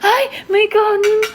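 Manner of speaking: high, delighted cry; thrilled to see rice; excited. Real, not acted. (tuwang-tuwa)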